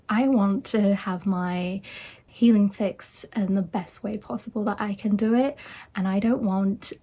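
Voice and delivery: ordinary talk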